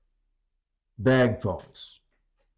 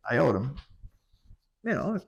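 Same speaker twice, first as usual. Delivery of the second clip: normal talking voice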